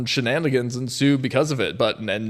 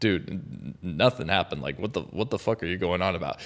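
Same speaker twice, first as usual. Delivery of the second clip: low, soft, dismissive; scoffing shopkeeper; 8 semitones lower, 10 dB quieter than usual. Retold or imitated. Shopkeeper scoffing at the panicked man, low and dismissive. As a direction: wave him off like he's crazy.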